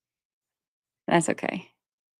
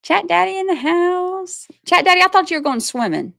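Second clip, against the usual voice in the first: high, sing-out hail to someone dear; playful call-out; 11 semitones higher than usual. Real, not acted. Streamer hails husband watching her live, playful high call-out. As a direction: tease him in a high-pitched voice.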